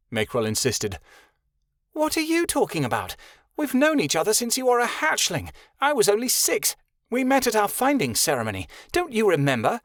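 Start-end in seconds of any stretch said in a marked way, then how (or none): 1.9-9.8 high, pleading insistence; much higher, earnest pleading; insisting. Acted. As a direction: urge him to remember, desperate. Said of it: "Anna insisted".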